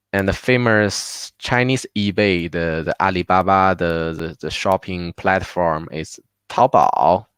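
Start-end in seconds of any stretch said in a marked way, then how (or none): none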